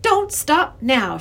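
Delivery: slow, high, clear command. A narrator's reading. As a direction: urge on clearly and emphatically. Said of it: "she said in a clear voice".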